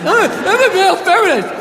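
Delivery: mock him as an eager-to-please man; mock male feminist. Mocks male feminist again.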